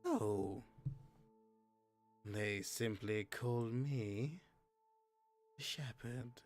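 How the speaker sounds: a quiet, mysterious voice from the darkness; dark whisper behind you. Acted. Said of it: "mysterious voice in the darkness".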